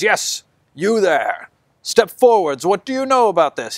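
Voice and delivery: high, commanding zealot; fanatic judge. Acted. Fanatic judge calls a witness forward, high and commanding. Fanatic questions crowd member.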